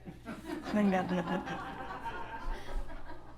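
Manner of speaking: soft unvoiced laugh; quiet soft laugh; breathy laugh. Laugh after threat.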